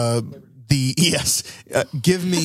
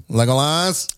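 lightly nasal Legolas call; a hint of Aragorn's nasal calling; nasally Aragorn voice; slower than usual. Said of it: "Aragorn says something in the nasally voice".

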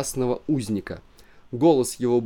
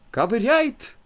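high alarmed shriek of outrage; alarmed cry; 11 semitones higher, slower than usual. A narrator's reading. German owner cries out in alarm. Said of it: "вскричал хозяин".

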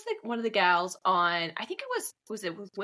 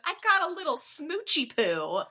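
lightly imitated gushing contestant, pitched up; higher, babyish little brag to the girls; mock cutesy boast; 8 semitones higher than usual. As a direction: put on a hint of cutesy gloating. Mimics contestant bragging to the girls.

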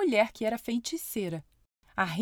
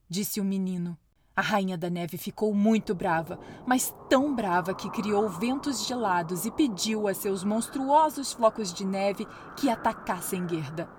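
ordinary delivery, nothing marked

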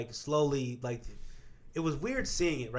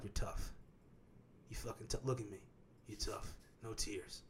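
whispered, much softer pep talk to oneself; self pep talk; 6 semitones lower, 15 dB quieter than usual. Retold or imitated. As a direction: hush it like steeling yourself not to cry. Psyching himself up at funeral.

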